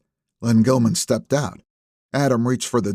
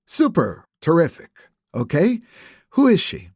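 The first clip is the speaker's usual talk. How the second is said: mock-cheery words, then a sharp high demand; sarcastic impatience; 6 semitones higher than usual. Police chief to evasive attorney, sarcastic impatience. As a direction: feign delight, then snap the question.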